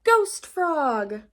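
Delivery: ghostly announcement. Acted. Froggy naming his ghost costume, loud and proud. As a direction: announce it like a spooky title.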